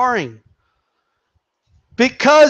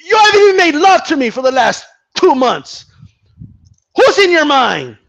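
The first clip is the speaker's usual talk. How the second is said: higher and louder, demanding; mock jealous wife; 7 semitones higher, 4 dB louder than usual. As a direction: play a suspicious wife grilling her man. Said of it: "The woman says".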